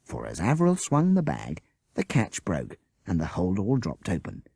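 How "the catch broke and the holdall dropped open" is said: usual speaking voice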